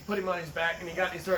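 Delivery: normal talking voice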